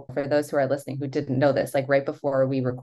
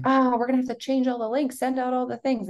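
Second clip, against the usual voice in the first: mock frustrated; 10 semitones higher than usual. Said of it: "I felt frustrated".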